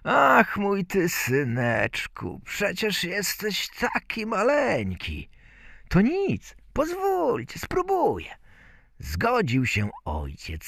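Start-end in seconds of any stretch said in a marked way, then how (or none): 0.0-5.3 slow opening, raised pitch; fond father fussing over his tiny son; doting father. A narrator's reading. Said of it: "zaczął prosić ojca". 5.9-8.3 very high pleading little voice; Bździelik. A narrator's reading. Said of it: "Bździelik tymczasem zaczął prosić ojca".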